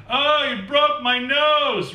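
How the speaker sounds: very high, slow, squeaky wail; funny whiny voice. A narrator's reading. Punched kid wails at narrator, very high slow funny voice. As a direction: ham up a silly pained cry. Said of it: "real loud in this funny voice".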